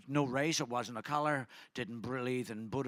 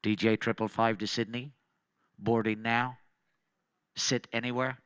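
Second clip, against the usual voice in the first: louder, lower PA call; mock announcement; 3 semitones lower, 5 dB louder than usual. To the crowd, taking over the counter mic, mock boarding call. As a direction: announce the flight like a gate agent. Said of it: "And I went".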